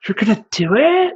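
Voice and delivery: put-on high, amazed girl voice; surprised girlfriend. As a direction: squeal of disbelief. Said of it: "the girl that I'm with is like".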